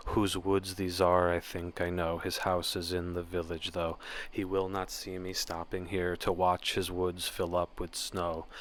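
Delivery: 조용하고 차분하게 시를 낭독; quiet poem reading. Acted. Reads the poem aloud.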